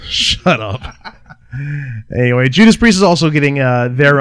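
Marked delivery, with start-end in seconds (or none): none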